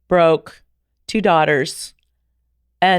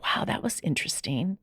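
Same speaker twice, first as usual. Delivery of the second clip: breathy whispered wonder; hushed whisper of private amazement; whispered aside; 10 dB quieter than usual. Retold or imitated. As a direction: whisper it, half to yourself. Inner reaction after her first meeting.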